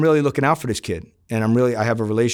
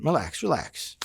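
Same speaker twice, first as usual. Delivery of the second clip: soothing relax; 4 semitones higher, 6 dB quieter than usual. Retold or imitated. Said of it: "I said".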